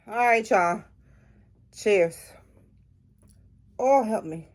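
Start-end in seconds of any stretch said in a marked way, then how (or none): none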